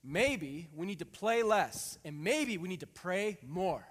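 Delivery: put-on loud, slow, preaching kid; daughter's sermon. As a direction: proclaim it slowly like a little preacher. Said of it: "The first line, okay, was".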